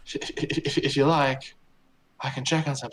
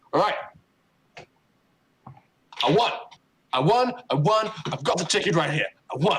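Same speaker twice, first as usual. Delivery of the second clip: cocky rival crowing he won; gloating victory; 5 semitones higher, faster than usual. Acted. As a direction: crow over the win. GM voices gloating rival NPC.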